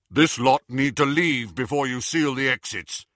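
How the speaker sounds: firm, official order; commanding instruction; authoritative. Acted. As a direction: put all your authority into it. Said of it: "with all the authority he could muster".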